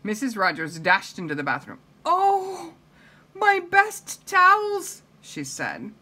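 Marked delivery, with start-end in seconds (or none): 2.0-5.0 high, loud, drawn-out lament; aghast, stretched-out high cry; dismayed outcry. Acted. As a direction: wail over a precious thing ruined. Mistress finds her towels ruined.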